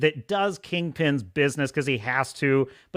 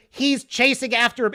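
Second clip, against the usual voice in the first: ordinary talk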